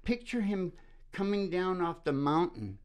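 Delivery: normal talking voice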